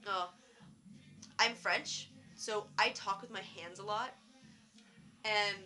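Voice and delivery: ordinary delivery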